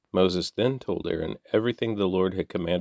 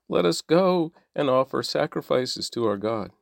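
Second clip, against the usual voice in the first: mock whiny plea, pitched higher; mocking whine; 7 semitones higher than usual. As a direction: ape the slaves' crying out with scorn. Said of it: "That's why they are crying out".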